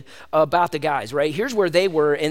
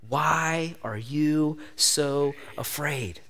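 very slow, soft, lower tender question; gentle question; 5 semitones lower, 7 dB quieter, much slower than usual. Acted. Jesus asking frightened disciples, slow and soft. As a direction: ask it like a patient friend. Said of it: "we see the question".

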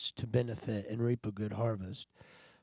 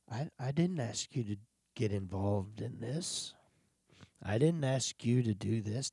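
softer, lower, gently correcting; gentle; 4 semitones lower, 7 dB quieter than usual. Retold or imitated. God gently answering the frustrated preacher, soft and calm. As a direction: speak as a tender father, quietly. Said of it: "And he so gently said".

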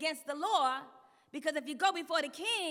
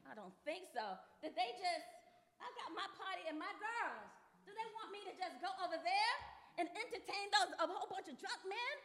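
hushed, affronted queen voice, pitched up; indignant queen; 3 semitones higher, 6 dB quieter than usual. Acted. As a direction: act softly offended at being summoned. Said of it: "she gets all, um, indignant".

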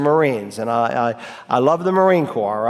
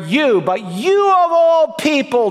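YOU stressed much higher and slow, You, but you of all people; emphatic; 9 semitones higher than usual. Shown as a demonstration. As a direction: lean hard on the YOU, slowly and high. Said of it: "It's emphatic".

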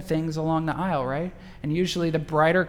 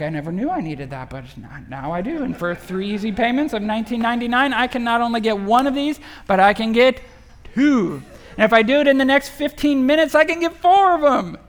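hammed-up TV pitchman, building higher and louder; infomercial pitch; 6 semitones higher, 7 dB louder than usual. Preacher mocking a late night infomercial pitch. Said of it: "late night T V infomercial".

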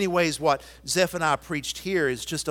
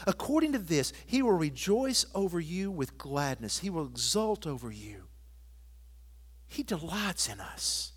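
much softer, intimate sermon voice; tender pastoral hush; 2 semitones higher, 4 dB quieter than usual. Acted. Pastor telling a child of God they are loved, softly. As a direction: lean in and speak it gently to each listener.

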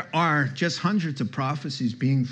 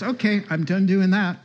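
higher, louder voicing of her giving in; retold concession; 7 semitones higher, 5 dB louder than usual. Visitor gives in after reading verse, voiced higher and louder. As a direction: act out her cheerful surrender.

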